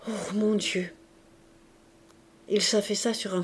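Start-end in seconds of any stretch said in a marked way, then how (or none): 0.1-0.9 breaking down in dismay; despairing. A narrator's reading. Jean Valjean collapsing before Marius, despairing. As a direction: groan it out in despair.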